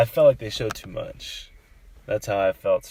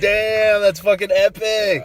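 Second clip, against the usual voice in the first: awed excitement; 9 semitones higher, 6 dB louder, slower than usual. Retold or imitated. Retold thrilled reaction continues.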